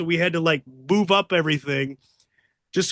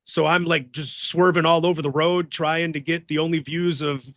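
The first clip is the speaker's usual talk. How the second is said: normal talking voice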